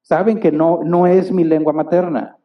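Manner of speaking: normal talking voice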